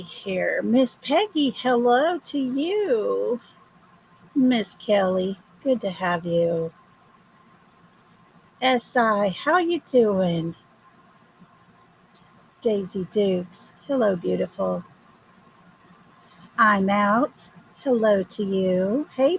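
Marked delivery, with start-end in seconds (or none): none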